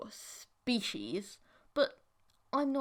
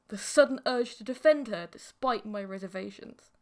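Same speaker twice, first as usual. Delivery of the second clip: ordinary delivery, nothing marked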